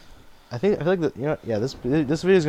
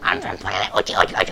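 fast alien gibberish voice; 5 semitones lower, faster than usual. Retold or imitated. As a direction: chant it like a silly alien. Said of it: "doing this alien voice".

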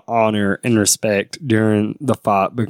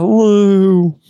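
mock cry; 9 semitones higher, 6 dB louder than usual. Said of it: "He's like".

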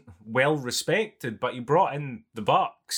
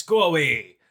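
lightly acted, louder brush-off; mock dismissal; 2 semitones higher, 5 dB louder than usual. Retelling a director rejecting a dancer, mock dismissive. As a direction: send the dancer packing, half in jest.

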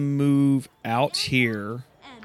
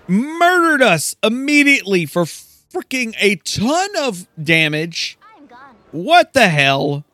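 frustrated outburst; 7 semitones higher, 5 dB louder than usual. Real, not acted. Reacting to party wipe.

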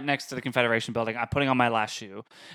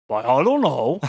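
louder, shrugging mock innocence; feigned innocence; 5 dB louder than usual. Pretending ignorance to girlfriend, shrugging mock innocence. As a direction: play dumb with a shrug. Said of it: "I was like".

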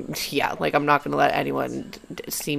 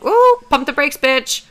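high, loud self-scolding that eases off toward the end; inner voice; 6 semitones higher, 5 dB louder than usual. Acted. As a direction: rein yourself in with a high, urgent pep talk. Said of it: "my brain wasn't stopping myself and going".

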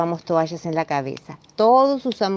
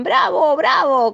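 higher, louder bravo calls; cheering; 8 semitones higher, 4 dB louder, slower than usual. Acted. Family applauding Marina's essay, cheering. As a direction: cheer like a theatre crowd.

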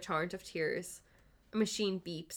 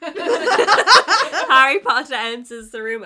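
laughing announcement; 7 semitones higher, 18 dB louder, much slower than usual. Real, not acted.

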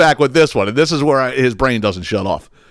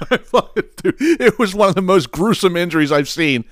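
laughing; 7 semitones higher than usual. Real, not acted. Laughing at gruesome injury.

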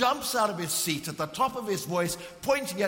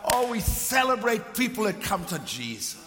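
raised, ringing preacher's voice; full-force preaching; 4 semitones higher, 6 dB louder than usual. Acted. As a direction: preach it out passionately to the congregation. Preaching climax of the story.

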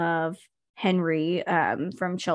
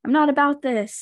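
mild mock scolding, pitched up; retold scolding; 6 semitones higher, 4 dB louder than usual. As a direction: imitate someone telling a friend off. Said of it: "she scolds Miss Patty".

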